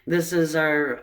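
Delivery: ordinary talk, nothing marked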